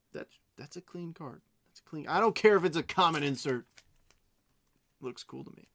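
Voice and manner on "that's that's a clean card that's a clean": hushed admiration. Real, not acted. Admiring a card softly.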